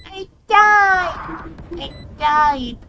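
high loud cry of defeat; dismayed shout. Real, not acted. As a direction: yell out as you go down. Character killed in game.

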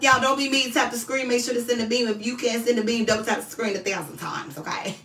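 normal talking voice

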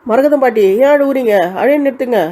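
high, loud, concerned calling; urgent concern. Acted. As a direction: plead loudly for the crying to stop. Calls to crying old woman.

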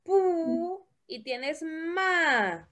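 slow, with PU and MA stretched; slow sounding-out. Shown as a demonstration. Teacher helps Christopher join syllables, drawn out slowly. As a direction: dwell on each syllable, very slowly.

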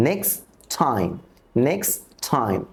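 Next said as nex, straight into time; next time without t. Shown as a demonstration. Teacher demonstrating elision for learners, t left out. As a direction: drop the final t of Next before time. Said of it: "আমরা টি এবং ডির উচ্চারণ করব না".

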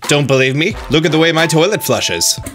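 put-on Australian accent; jokey fake Australian accent. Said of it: "You may be asking about my strange Australian accent".

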